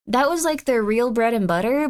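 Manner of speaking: ordinary talk, nothing marked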